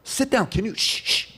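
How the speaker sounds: high-pitched, urgent shushing; irritated audience hushing. Acted. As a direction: act out listeners straining to hear, annoyed. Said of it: "It was like this".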